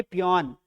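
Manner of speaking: पियोन with an open o, "pee-YON"; पियोन, "aw" vowel before the n; taught reading of peon. Shown as a demonstration. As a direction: model पियोन as a single falling word. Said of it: "चपरासी को क्या बोलेंगे".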